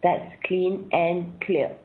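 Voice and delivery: ordinary talk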